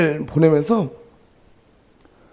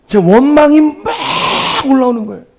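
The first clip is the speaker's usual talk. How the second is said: rising resentment; 6 semitones higher than usual. Retold or imitated. Preacher reliving his grudge on the way to the ER, strained. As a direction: let the bitterness surge into the voice.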